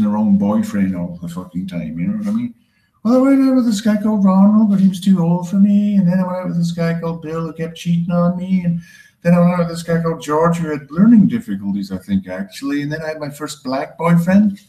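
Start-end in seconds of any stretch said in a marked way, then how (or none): 3.0-14.6 put-on high, chatty girlfriend-gossip voice; gossipy woman. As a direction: dish about exes like a gossiping girlfriend. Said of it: "a woman who keeps choosing".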